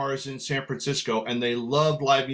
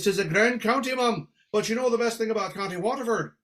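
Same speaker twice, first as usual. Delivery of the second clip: higher, lilting Irish brogue; 6 semitones higher than usual. Retold or imitated. Singer setting up joke for little lady, higher Irish brogue. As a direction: set up the tease in an Irish lilt.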